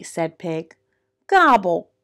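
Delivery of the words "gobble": loud, grumbled turkey gobble; mock-grumpy turkey; grumbling gobble. A narrator's reading. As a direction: gobble grumpily, punching the second. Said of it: "grumbled Turkey".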